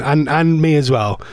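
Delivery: normal talking voice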